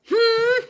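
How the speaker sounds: loud, squeaky questioning "hmm"; high-pitched hum. Retold or imitated. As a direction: hum back sharply, way up high. Acted reply to dad again.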